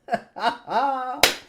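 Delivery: short scornful laugh; mocking laugh. Real, not acted. Laugh after sarcasm.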